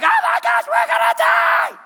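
high, loud panicked shriek; hammed-up terrified screaming; freaking out. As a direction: scream it like the boat is sinking. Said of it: "the disciples are freaking out".

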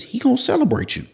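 very high excited squeal; thrilled preaching. Real, not acted. Preacher relishing that God will celebrate you, thrilled. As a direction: let the delight leap into falsetto.

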